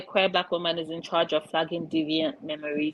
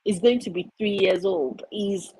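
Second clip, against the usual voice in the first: ordinary talk, nothing marked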